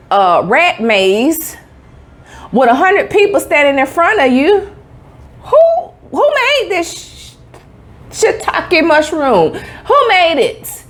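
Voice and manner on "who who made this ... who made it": high, slow incredulous demand; mock outrage. Real, not acted. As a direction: stretch it out, high and slow, as if someone must answer for this.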